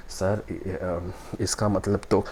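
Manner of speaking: slow, soft, halting mock-nervous reply; a flustered candidate stalling, mildly imitated; confused stammer. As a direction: stall like a lost interview candidate. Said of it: "you are confused".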